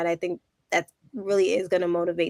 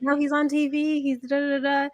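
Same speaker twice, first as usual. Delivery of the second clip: insistent hype; 7 semitones higher than usual. Retold or imitated. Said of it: "And I'm like".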